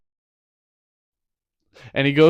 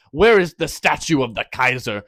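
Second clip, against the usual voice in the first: louder, hammed-up villain's demand; mock demand; 7 dB louder than usual. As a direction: demand it loudly, playing the interrogator. Villain's sudden demand in retold story.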